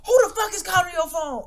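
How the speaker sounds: high, loud mock scolding; retold angry demand. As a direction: imitate a mother angrily confronting her kid. Retold mother confronting sleeping child.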